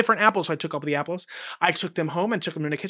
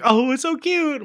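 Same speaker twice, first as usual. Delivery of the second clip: a much higher, gushing coo; gushing kid; 9 semitones higher than usual. Retold or imitated. One kid in class gushes over the mouse in a high coo. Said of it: "one kid that's like".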